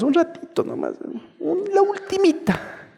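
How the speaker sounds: hammed-up pleading with God; wheedling mock plea. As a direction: beg like a child asking for one last treat. Believer begging for one last sin.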